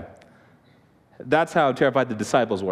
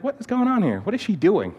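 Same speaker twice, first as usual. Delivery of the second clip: high-pitched, mock-bewildered question; baffled retold reaction; 8 semitones higher than usual. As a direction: act out being puzzled by noises in the night. Puzzled by footsteps at night.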